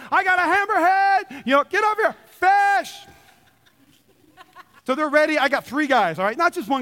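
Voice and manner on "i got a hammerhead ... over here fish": high shouting for help; excited yell. Retold or imitated. Fisherman yelling to the crew about his catch, high shout. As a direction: bellow for the crew to come. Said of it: "I'm going".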